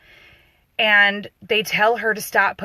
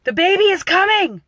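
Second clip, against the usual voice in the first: high, loud panicked yell; retold scream; 11 semitones higher, 6 dB louder than usual. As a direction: yell it out like a frantic husband. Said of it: "he screams".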